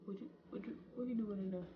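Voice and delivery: very soft, hushed asking; timid whisper. Retold or imitated. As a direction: barely voice it, scared. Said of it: "I said".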